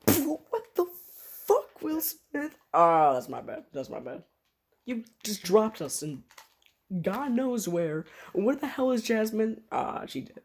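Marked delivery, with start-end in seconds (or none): 0.1-2.6 much higher, shocked and drawn out; a stranded hero yelling up at the genie; outraged high character voice. Pretend. Hero stranded by genie, yelling in high shock. 4.8-9.6 higher and slow, exasperated hero; high-pitched hero voice. Pretend. Plays the hero character.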